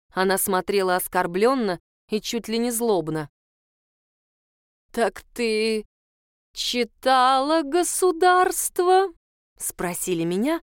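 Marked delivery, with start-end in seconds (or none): 4.9-9.1 drawn-out, rising, ominous question; slow and suspicious, much higher; menacing question. Acted. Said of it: "нехорошие нотки прозвучали в вопросе". (зловеще, с нехорошими нотками)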